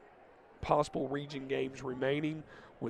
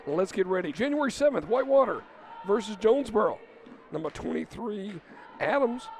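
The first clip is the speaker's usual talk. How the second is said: play-by-play call; 5 semitones higher than usual. Acted. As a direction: call the action to the crowd.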